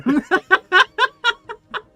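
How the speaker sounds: loud, high burst of laughter; helpless high cackle; big laugh. Real, not acted. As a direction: crack up at your own story. Laughs at own retold story.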